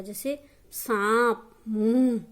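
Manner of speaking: सांप and मुंह said slowly and loud with a nasal vowel; teacher sounding out सांप, मुंह with a clear nasal vowel; nasalized vowel demo. Shown as a demonstration. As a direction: push the vowel of सांप through nose and mouth, slowly. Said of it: "उच्चारण नाक और मुख से होता है".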